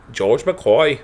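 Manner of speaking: half-laughed, uncertain Australian accent; attempted Australian accent. Shown as a demonstration. Cohost asked for Australian on the actor's name, botched accent. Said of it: "an Australian accent?".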